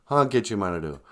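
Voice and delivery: ordinary talk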